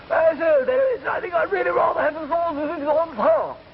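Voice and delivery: very high, garbled British bluster; caricature British gentleman. Acted. British diner ordering, high garbled British voice. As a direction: splutter unintelligibly in an exaggerated British accent. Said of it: "The man looked up and he said".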